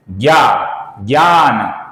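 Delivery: ज्ञान said as "gyaan"; Hindi pronunciation. Shown as a demonstration. Teacher showing how Hindi does it, ज्ञान as "gyaan". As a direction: open ज्ञा and ज्ञान with "gy". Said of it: "Hindi में कैसा करते हैं".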